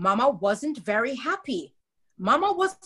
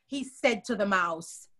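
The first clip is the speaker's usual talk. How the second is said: plain reading voice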